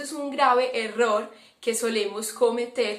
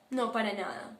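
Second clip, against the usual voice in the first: much softer, curt mock denial; denying being bothered; 7 dB quieter than usual. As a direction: brush it off while clearly annoyed. (negación seca y suave, fingida)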